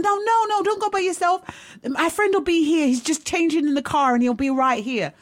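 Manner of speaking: high-pitched imitation of insistent guys; urgent dissuading. Voices guys urging her to wait.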